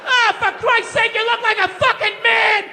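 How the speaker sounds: mock outraged father shouting; blunt dad. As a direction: blurt it like a tactless dad. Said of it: "He just goes".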